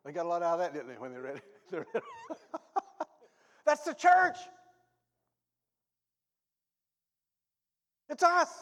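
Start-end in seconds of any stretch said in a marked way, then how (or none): 1.8-3.0 high burst of laughter. Real, not acted. 3.7-8.5 fiery preaching. Real, not acted. Preaching at full force.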